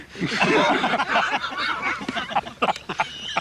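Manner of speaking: high breathless burst of laughter. Real, not acted. Laughing at her own story of the Swami. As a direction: break into helpless laughter.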